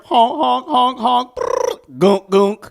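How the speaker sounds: high clown honks and raspberry; silly clown. Pretend. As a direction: honk like a goofy clown horn. Clowning noises on live stream.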